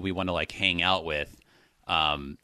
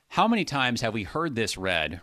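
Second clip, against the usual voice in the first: normal talking voice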